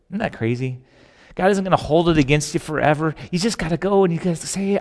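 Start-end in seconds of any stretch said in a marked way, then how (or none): none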